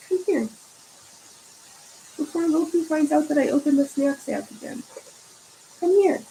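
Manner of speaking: put-on high baby-talk voice for a pet; coaxing pet voice. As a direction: lure the cat over with treats, sweetly. Coaxing cat with snacks.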